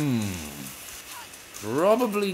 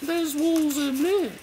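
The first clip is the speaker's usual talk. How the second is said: high-pitched game heroine, chatty; lively high character voice; Faith voice. Acted. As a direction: put on a much higher voice for the heroine. Returns to Faith voice.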